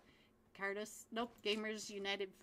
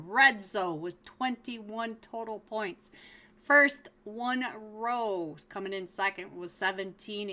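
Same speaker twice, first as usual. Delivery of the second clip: announcer voice; 6 dB louder than usual. Acted. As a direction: welcome the crowd like a caster. Opens the finals broadcast.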